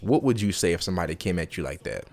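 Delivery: normal talking voice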